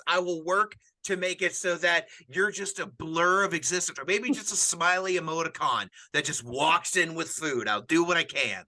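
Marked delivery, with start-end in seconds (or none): none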